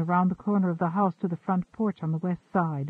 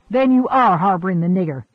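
loud, fast furious outburst; explosive anger; 9 dB louder, faster than usual. A narrator's reading. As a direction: explode at him in rage. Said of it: "Gabe exploded".

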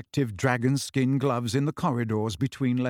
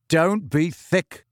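firmly; 4 semitones higher, much slower than usual. A narrator's reading. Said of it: "said Harry firmly".

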